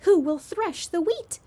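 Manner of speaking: a hen hopefully calling for help. A narrator's reading. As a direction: ask out hopefully, expecting a yes. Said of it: "in a very hopeful tone".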